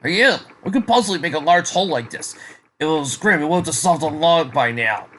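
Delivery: insistent arguing. Acted. Soldier arguing with partner over the crater, insistent.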